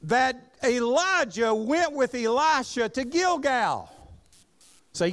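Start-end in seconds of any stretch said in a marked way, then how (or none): 0.0-3.9 raised, ringing pulpit reading of scripture. Acted. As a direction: read the verse out with preaching force. Reading scripture aloud in sermon.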